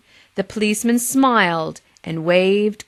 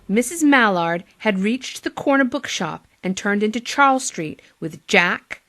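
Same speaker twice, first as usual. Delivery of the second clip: usual speaking voice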